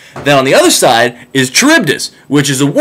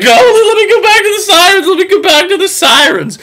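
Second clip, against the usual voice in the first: much higher, shouted pleading; whiny begging Odysseus. Retold or imitated. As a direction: beg like a desperate man tied to a mast. Odysseus begging, tied to mast.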